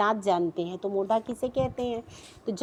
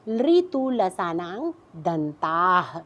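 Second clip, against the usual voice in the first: लृतु लसानांग दंताः spelled out slowly, syllable by syllable; slow sutra recitation; 2 semitones lower, much slower than usual. Shown as a demonstration. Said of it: "तो आप सूत्र पे आ जाइए सामने".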